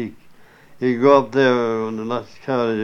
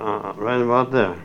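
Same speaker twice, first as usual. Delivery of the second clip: normal talking voice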